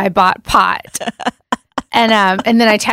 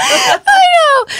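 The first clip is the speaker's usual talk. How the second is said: high laughing shout; laughing cry. Real, not acted. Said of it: "So aggressive on the edible".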